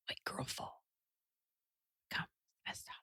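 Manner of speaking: breathy mock whisper. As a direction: whisper it like a secret. Whispered joke about fall.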